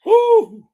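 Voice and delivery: very high, loud "woo"; yelled whoop. Real, not acted. Host pumping up the sleepy podcast, yelled whoop. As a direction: let out a piercing hype whoop. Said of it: "I'm sorry, I'm yelling here".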